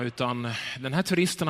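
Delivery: ordinary delivery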